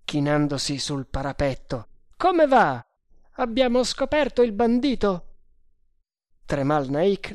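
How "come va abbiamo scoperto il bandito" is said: high-pitched, loud called question; shouting. A narrator's reading. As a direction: holler it down from the parapet. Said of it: "gridò Bharata". (domanda gridata, con voce acuta)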